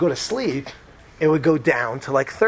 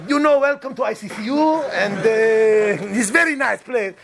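mock Israeli hospital welcome, pitched up high; Israeli-French accent; 5 semitones higher than usual. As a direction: greet them in a put-on French-tinged Israeli accent. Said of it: "apparently they're French also".

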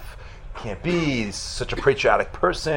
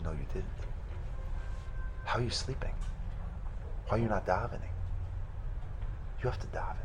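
hushed father's rebuke; 8 dB quieter, faster than usual. Retold or imitated. Father scolds in dream.

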